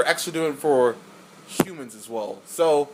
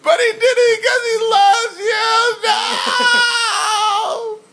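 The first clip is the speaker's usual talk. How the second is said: very high, loud mock wail; hammed-up bawling; 7 dB louder, much slower than usual. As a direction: wail it out slowly in exaggerated heartbreak. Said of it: "that's how I was acting like".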